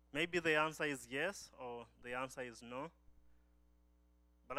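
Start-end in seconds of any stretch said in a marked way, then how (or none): none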